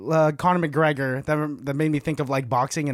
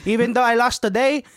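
Manny Pacquiao; 4 semitones higher than usual. Retold or imitated. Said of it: "I'm Manny Pacquiao".

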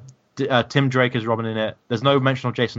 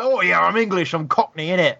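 lightly put-on Cockney accent, a bit higher; mock Cockney accent; 6 semitones higher than usual. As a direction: parody of a bad Cockney accent. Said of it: "racist English accent".

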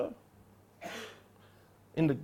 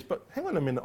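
ordinary talk

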